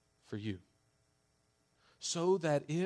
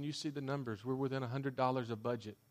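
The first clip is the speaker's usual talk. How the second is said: ordinary talk, nothing marked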